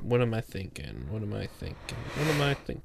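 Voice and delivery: hushed murmur while searching memory; whispering to self. Real, not acted. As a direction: mutter it under your breath. Thinking aloud, whispering to self.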